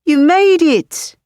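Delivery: high, loud, slow British cheer; delighted British welcome; thrilled British roommate greeting; excited British exclamation. Acted. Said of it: "he says in a heavy British accent".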